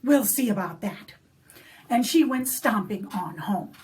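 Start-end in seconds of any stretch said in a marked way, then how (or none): 0.0-1.1 a loud, grim little threat. Acted. Molly threatening the leprechaun, loud and grim. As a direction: bark it as a warning. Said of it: "She says".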